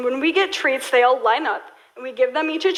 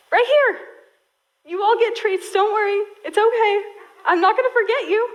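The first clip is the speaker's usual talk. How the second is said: much higher, gentle soothing promise; reassuring God; 8 semitones higher than usual. Retold or imitated. God comforting Hagar like a pet owner, light soothing. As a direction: comfort her like a worried pet. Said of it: "And he says".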